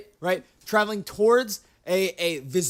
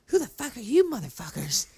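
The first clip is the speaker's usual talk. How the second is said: mock lady voice; 3 semitones higher than usual. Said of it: "She's like".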